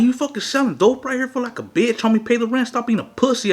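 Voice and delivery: high-pitched, indignant scolding; retold angry tirade. Storyteller confronting a man selling dope out front, re-enacted indignation. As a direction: re-enact telling him off.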